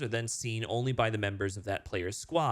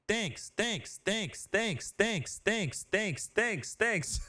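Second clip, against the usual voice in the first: high, repeated rapid thanks; spammed chat callout; 7 semitones higher, much slower than usual. Pretend. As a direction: mimic a player spamming. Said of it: "people will be able to just spam this".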